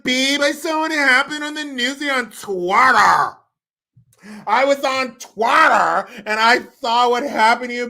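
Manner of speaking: high, loud, over-sweet babe voice, slowing at the end; mock gushing husband. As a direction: gush at her like a doting fiancé. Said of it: "that's her husband".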